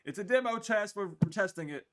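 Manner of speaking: talking through hiccups; hiccupy, catching breath. Real, not acted. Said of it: "I got the hiccups".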